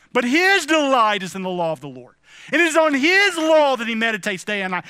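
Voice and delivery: loud, ringing pulpit blessing; fervent preacher's benediction; preaching blessing. Acted. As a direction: proclaim the psalm over them at full force.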